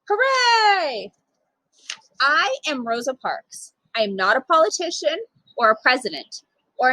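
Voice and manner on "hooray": high cheer, drawn out and falling; child cheering at the end; happy cheer. Acted. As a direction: let out a big joyful cheer.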